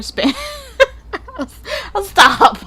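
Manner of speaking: breaking into high laughter mid-word; giggling. Real, not acted. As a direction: give up the joke through laughter. Cracks up at own joke.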